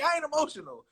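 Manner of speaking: high squeaky defensive denial; mock denial. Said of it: "I'm like".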